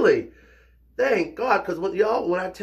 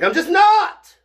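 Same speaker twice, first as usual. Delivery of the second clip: much higher, louder squeal of denial; dramatic denial; 10 dB louder, slower than usual. Acted. Insisting he isn't dramatic, in a high loud squeal. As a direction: overdo the denial to prove the point. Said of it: "I'm not dramatic".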